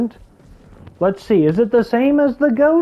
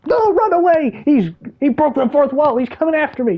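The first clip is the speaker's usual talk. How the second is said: much higher, hammed-up fright; mock panic; 7 semitones higher than usual. Player jokes the dinosaur is coming for him, mock panic much higher. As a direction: pretend to flee the dinosaur, pitched up.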